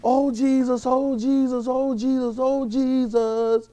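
a high, slightly drawn-out mock plea to Jesus; mock pleading believer. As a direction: lightly play a whiny believer calling over and over. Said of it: "he really want us to be".

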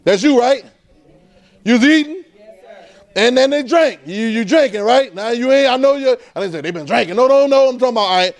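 preaching. Acted. Pastor challenging congregation about buying, fervent. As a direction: drive it at the crowd.

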